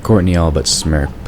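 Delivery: ordinary delivery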